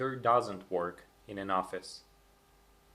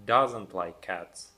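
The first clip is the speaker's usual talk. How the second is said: doesn't slow and louder; stress on doesn't; 2 semitones lower, 5 dB louder, slower than usual. Shown as a demonstration. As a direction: lean on doesn't, slow and firm. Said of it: "Say it in a negative".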